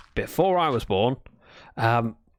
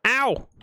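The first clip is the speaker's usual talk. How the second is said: high yelp of pain; sharp little ow; 11 semitones higher than usual. Real, not acted. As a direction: yelp as if it stings. Hurt in game.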